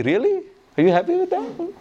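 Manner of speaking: high-pitched incredulous question; surprised disbelief. Retold or imitated. Master surprised at the servant's tiny request, incredulous.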